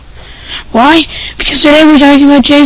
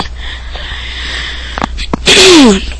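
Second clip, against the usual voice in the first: throat clear; 7 semitones higher, 6 dB quieter than usual. Real, not acted.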